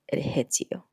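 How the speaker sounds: hushed, unvoiced repeat for weight; whispered. Shown as a demonstration. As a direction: whisper it so it lands. Repeated line, whispered emphasis.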